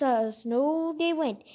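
usual speaking voice

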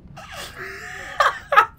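high shrieking laugh after a scare; scream-laugh. Real, not acted. Gamer after a jumpscare, high shrieking laughter.

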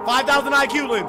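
bragging shout. Real, not acted. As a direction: show off proudly to chat. Bragging loudly about solving puzzle.

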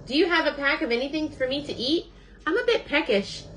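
high-pitched storybook bird; hungry Bird. A narrator's reading. Bird asks Fox for food, high character voice. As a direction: voice a peckish little bird, pitched well up.

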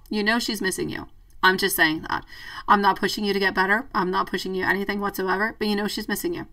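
ordinary talk, nothing marked

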